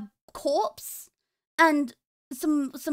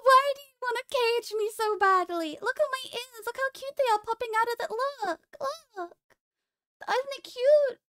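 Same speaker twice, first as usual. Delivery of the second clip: cute bunny plea; 7 semitones higher than usual. Acted.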